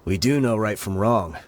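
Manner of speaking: usual speaking voice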